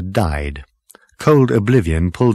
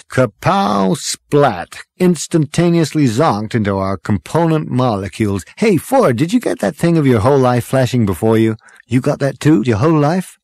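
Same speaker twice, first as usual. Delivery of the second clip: louder, higher-pitched lively storyteller; breezy braggart; 6 semitones higher, 4 dB louder than usual. A narrator's reading. Zaphod tells how he didn't survive, breezy. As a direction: recount it with punchy swagger. Zaphod recounts death to Ford.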